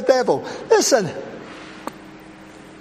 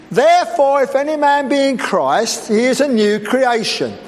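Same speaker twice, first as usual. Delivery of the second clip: building preaching; 5 semitones higher than usual. Acted. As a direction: build the scripture up to a shout. Preacher quoting scripture, rising.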